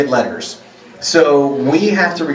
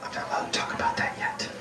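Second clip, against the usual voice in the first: secretive whisper; conspiratorial hushed whisper; 15 dB quieter than usual. Real, not acted. Joking secret aside.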